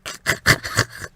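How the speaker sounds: wheezy laugh that sounds like a cough. A narrator's reading. Mother amused by the girl's answer, with a coughing laugh. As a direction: hack out a laugh. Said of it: "something of a laugh, though it sounded like a cough".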